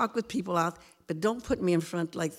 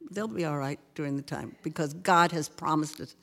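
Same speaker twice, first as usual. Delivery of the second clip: ordinary talk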